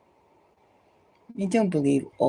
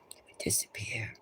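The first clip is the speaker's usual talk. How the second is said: hushed, knowing whisper; 7 semitones lower, 13 dB quieter, faster than usual. Retold or imitated. Telling viewers what to say, whispered. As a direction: let the doubt drop to a whisper. Skeptical aside to yourself.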